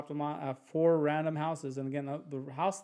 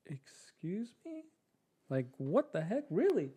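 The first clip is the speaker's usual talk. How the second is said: a drawn-out stunned excuse me, then quick and higher; stunned disbelief; 4 semitones higher, 4 dB quieter, slower than usual. Retold or imitated. A stranger offers to sell him her house, he answers in stunned disbelief. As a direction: play a man who can't believe the offer.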